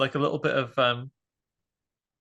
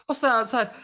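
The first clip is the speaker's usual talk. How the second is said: high curious voice; 10 semitones higher, 3 dB louder than usual. Pretend. Voicing character.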